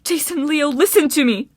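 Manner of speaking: high, desperate call; urgent, emotion-filled appeal; all-out emotional plea. A narrator's reading. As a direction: beg them to listen. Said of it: "put all of her emotion into her voice".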